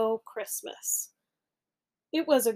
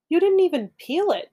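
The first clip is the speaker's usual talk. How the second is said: higher, louder incredulous protest; appalled that the banana went in unpeeled; a louder, higher gasp of disbelief; disgusted; 8 semitones higher, 5 dB louder than usual. Acted. Jim disgusted at Norman.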